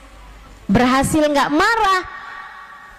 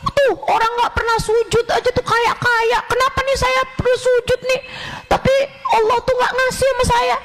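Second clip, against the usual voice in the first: higher, whiny complaining voice; mock complaining; 10 semitones higher than usual. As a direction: mimic a grumbling ungrateful person, half-crying. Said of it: "keluh kesah mulu".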